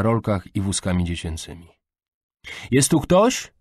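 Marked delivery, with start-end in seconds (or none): 2.7-3.5 raised, higher questioning call; loud hopeful shout through a doorway. A narrator's reading. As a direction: call into the house to see who is there. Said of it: "wykrzyknęła".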